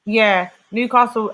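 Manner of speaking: ordinary talk, nothing marked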